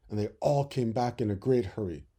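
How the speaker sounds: usual speaking voice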